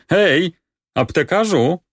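high-pitched, carrying call; loud calling out. Acted. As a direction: call out to summon someone from inside. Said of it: "Któż to woła takim donośnym głosem".

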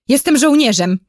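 restrained exclamation; crying out. A narrator's reading. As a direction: insist like an indignant captive. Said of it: "krzyknął Maciuś".

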